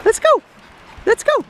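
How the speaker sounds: high, eager call to the dogs. Real, not acted. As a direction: sing out to get the dogs moving. Calling the dogs to come.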